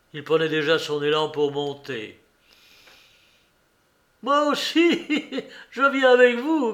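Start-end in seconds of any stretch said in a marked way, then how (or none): 4.2-6.7 high, laughing shout; laughing cry. A narrator's reading. Breathless Maximov begging a ride, laughing. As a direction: burst out with a laugh. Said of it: "cria-t-il en riant".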